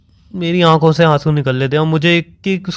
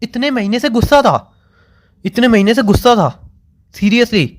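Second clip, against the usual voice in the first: hurt disbelief; 6 semitones higher than usual. Real, not acted. Hurt disbelief at her excuse.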